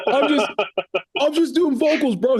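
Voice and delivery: laughing, pitch way up; sheepish laughing excuse; high laughing, caught out and embarrassed; laughing defensive reply. Retold or imitated. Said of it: "I was like".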